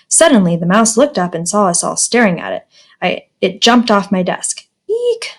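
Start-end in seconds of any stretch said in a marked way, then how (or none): 4.9-5.3 short squeal, much higher; a quick high eek; screamed. A narrator's reading. Everyone sees the mouse jump, screaming. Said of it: "everybody screamed".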